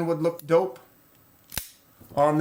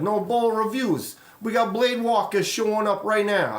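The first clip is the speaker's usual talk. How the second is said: higher, playful put-on voice while greeting chat; odd put-on voice; 6 semitones higher than usual. Real, not acted. Greeting livestream viewers in a higher goofy voice. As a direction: pitch it up and goof around. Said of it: "I don't know why I'm talking like this".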